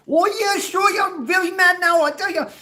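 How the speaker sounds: very high, loud Midwestern rant; pissed-off squeaky man. Acted. Big grown man getting mad, in loud falsetto rage. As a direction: squeal with mock rage, pitch way up. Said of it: "the higher pitch his voice gets".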